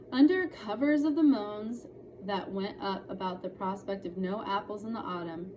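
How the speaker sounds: usual speaking voice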